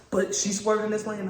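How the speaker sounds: normal talking voice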